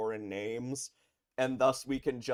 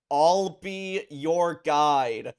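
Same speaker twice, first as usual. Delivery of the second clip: drawn-out, loud mocking echo of the line, much slower; mocking repeat; 6 semitones higher, 12 dB louder, much slower than usual. Shown as a demonstration. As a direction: stretch every word out to mock the pun.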